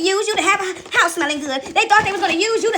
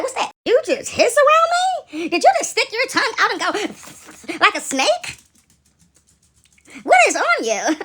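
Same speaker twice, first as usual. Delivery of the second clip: pitched way up and slow, mock disbelief; mock shocked squeal; 5 semitones higher, slower than usual. Asking someone who hissed, in mock shock. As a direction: squeal in fake horror at the snake. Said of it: "and you like".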